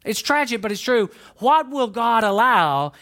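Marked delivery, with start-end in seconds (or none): 1.4-2.9 slow, louder, whiny mock of the believer asking "allow"; mocking the wrong question. Pastor mocking the congregation's wrong question, slow and whiny. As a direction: drag out "What will God allow?" as a grudging bargain. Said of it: "instead of asking".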